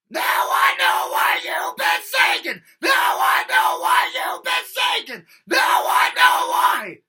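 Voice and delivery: very loud, high, slow screaming; compressed rock scream. Shown as a demonstration. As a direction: scream as loud as you can. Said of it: "just screaming as loud as he can".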